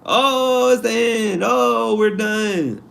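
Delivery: high, slow, whiny mock despair; mocking people who cry doom, high and drawn out; mock doomsayer. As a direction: ham up the panicking pessimist. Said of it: "Like, mock doomsayers".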